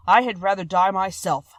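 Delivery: a loving monster pleading; Beast voice. A narrator's reading.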